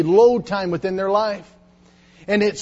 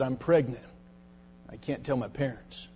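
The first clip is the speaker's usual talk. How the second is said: soft, much lower, barely above a breath; hushed confession; 6 semitones lower, 11 dB quieter than usual. Retold or imitated. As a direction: let it fall away, ashamed. Girl's tearful confession at altar.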